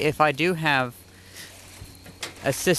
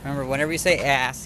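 ordinary talk

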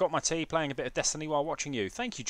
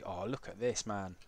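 ordinary talk

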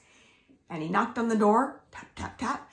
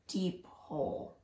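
whisper; 6 semitones lower, 12 dB quieter than usual. A narrator's reading. Whispered story emphasis.

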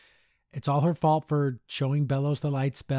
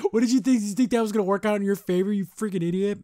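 louder, higher, fast disbelieving scolding; exasperated rant; 6 semitones higher, 4 dB louder, faster than usual. Real, not acted. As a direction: chew out the character on screen, speeding up. Ranting at a cartoon character.

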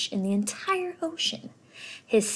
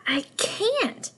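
much higher, cut-off protest; 11 semitones higher than usual. Acted. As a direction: start to object, then stop. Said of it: "the Rainbow Fish started to say".

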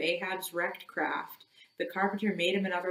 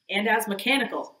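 plain reading voice

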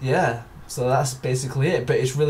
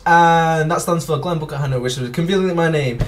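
ordinary talk, nothing marked

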